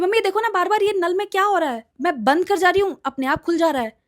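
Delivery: put-on high, loud complaining girl; upset daughter. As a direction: whine to mom about the tap. Daughter complains about tap.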